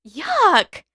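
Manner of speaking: high, loud "Yuck!" of disgust; disgusted yelp. A narrator's reading. Katara pulling her licked hand away, disgusted yelp. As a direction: recoil from the lick.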